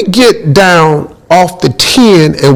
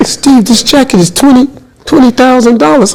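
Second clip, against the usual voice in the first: higher, proud Michael voice; lightly voiced Michael, matter-of-fact about the price; Michael telling Steve what his jacket cost, higher and pleased; imitating [name]; 9 semitones higher than usual.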